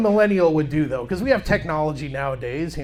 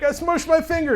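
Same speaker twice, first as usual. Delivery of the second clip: put-on whiny kid; 10 semitones higher than usual. Kid whining to dad.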